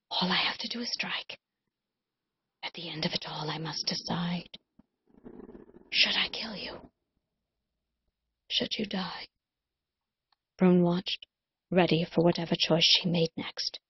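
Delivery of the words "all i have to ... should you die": whisper. Acted. Cullen's hushed deliberation over Rune.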